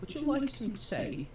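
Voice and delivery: high, slow posh-Cockney butler voice; mixed posh and Cockney London accent; British accent. Pretend. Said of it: "do an American accent and [name] do a British accent".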